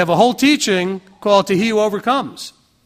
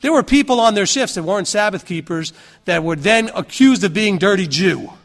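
usual speaking voice

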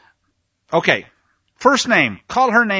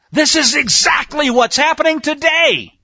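preacher's shout; 9 semitones higher, 5 dB louder than usual. Real, not acted. Preacher warns the nation.

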